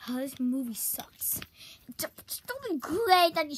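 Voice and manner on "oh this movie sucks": hushed aside; whisper. Acted. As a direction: whisper it behind a hand.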